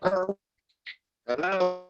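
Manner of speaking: ordinary delivery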